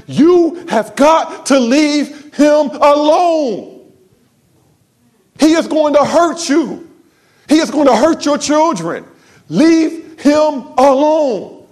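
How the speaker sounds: slow, high, earnest pleading; heartfelt plea. Retold or imitated. Pastor pleading with the woman to leave, slow and earnest. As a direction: beg her slowly with a broken heart.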